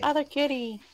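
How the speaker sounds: high call to another cat; excited calling. Real, not acted. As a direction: squeal for the kitty.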